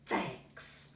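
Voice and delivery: breathy whisper of thanks; touched bear, barely voiced. Bear overwhelmed, says thanks softly.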